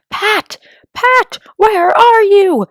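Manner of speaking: angry rabbit shout. Acted. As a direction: shout furiously for Pat. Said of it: "Next came an angry voice, the rabbit's".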